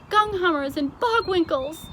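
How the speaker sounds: high, loud giant exclamation; BFG crying out. A narrator's reading. The BFG startled by the chandelier, crying out loud. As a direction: bellow it out as the giant. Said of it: "he cried".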